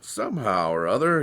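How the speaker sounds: ordinary delivery, nothing marked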